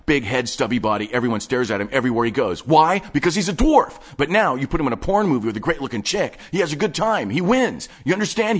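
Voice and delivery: breathless nerdy enthusiast showing off his stash; manic collector Wade. Acted. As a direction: rattle it off like an excited hoarder. Wade building his theory.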